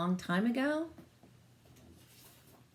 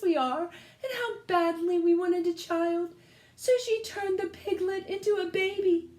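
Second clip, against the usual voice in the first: farmer's wife; 11 semitones higher than usual. Acted. Farmer's wife explains the baby.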